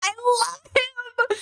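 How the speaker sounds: high, drawn-out fangirl gush; gushing delight. Real, not acted. Streamer reacting to the accent guesser's video, real gushing delight.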